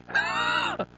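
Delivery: a sudden high-pitched laugh at his own joke; high burst of laughter. Real, not acted. Laughs at own sarcastic retort.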